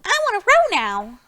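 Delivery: high, loud piglet voice; Small Pig wanting whales, high and eager; eager little pig. Acted. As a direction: squeal it like an excited small pig.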